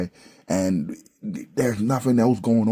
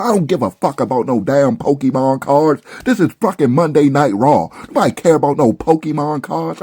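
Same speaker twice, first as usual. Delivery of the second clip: angry rant; 6 semitones higher, 5 dB louder than usual. Real, not acted. Ranting reviewer about Pokemon cards.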